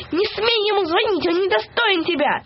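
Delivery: high-pitched mock wail of protest. A narrator's reading. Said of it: "взвыла попа". (с наигранным воем, высоко)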